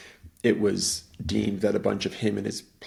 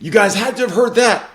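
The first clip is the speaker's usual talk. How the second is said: higher and louder, insisting; insistent call; 13 semitones higher, 14 dB louder than usual. Retold or imitated. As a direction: push them to admit it. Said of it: "And he's like".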